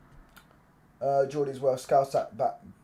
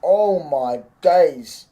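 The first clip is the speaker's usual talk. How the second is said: very slow, drawn-out, raised exclamation; loud amazement; 4 semitones higher, 8 dB louder, much slower than usual. Real, not acted. Streamer stunned by Oscar Bob, slow loud amazed exclamation. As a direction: stretch each word out in awe, loudly.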